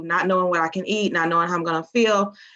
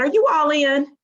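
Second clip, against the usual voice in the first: quoting Marion, higher and bright; upbeat challenge; 7 semitones higher than usual. Retold or imitated. Upline asking if she's committed, raised excited quote. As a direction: toss the question up as a rallying call. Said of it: "She said".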